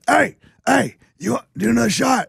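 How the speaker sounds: high, strained party-guy shouting; mock screamed drinking invitation; Tall Guy impression. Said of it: "he talks like this".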